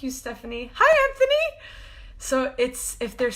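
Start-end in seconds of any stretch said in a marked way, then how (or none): 0.7-1.6 very high, loud greeting; squealed hello. Real, not acted. Spotting Anthony join the live, squealing with joy. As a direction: squeal out the name in delight.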